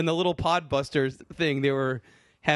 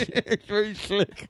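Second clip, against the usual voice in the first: slow, lips held apart; mouth propped open; 5 semitones higher, slower than usual. Pretend. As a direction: speak through teeth that won't close. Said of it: "doesn't actually work for chewing".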